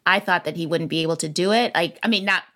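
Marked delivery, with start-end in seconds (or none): none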